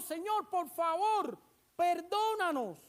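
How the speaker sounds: drawn-out, begging plea to the Lord; slow pleading prayer. Retold or imitated. Sinners begging the Lord for pardon: slow, pleading. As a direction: stretch each word as a humble plea for mercy.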